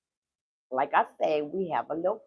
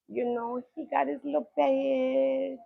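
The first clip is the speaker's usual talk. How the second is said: ordinary talk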